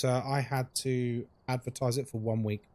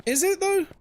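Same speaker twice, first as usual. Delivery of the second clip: very high, doubting squeak; sceptical query; 4 dB louder than usual. Real, not acted. Streamer still doubting a viewer's toast claim, sceptical. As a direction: question it with the pitch way up.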